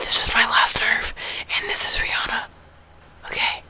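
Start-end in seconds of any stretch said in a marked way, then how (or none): 0.0-2.5 whispering. Real, not acted.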